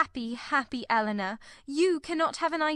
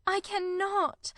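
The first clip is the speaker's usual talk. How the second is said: slow, higher, anguished; cried; 13 semitones higher, slower than usual. A narrator's reading. Marianne refusing her sister, slow anguished cry. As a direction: drag it out in despair. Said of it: "cried Marianne".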